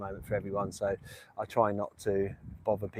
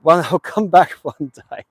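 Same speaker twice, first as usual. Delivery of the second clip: loud, slow, higher, breaking into a laugh; laughing repeat; 6 semitones higher, 13 dB louder than usual. Real, not acted. Streamer repeats his promise to bickering mate, laughing. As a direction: draw it out through a laugh.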